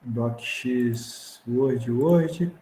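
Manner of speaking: ordinary talk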